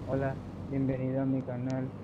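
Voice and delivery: low, soft, monotone mock greeting; deadpan, subdued streamer greeting; unenthusiastic welcome. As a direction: welcome them with no energy at all. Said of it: "no voy a dar la bienvenida así como".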